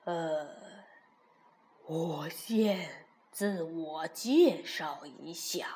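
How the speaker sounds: low, slow bumbling bear; a big hesitant bear. A narrator's reading. The bear introduces himself.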